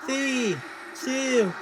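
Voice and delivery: shouting the countdown, rising high; new year countdown crowd. Real, not acted. New Year's Eve countdown with the crowd.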